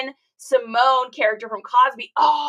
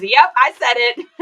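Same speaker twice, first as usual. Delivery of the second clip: mock voice of God; 9 dB louder, slower than usual. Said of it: "God says".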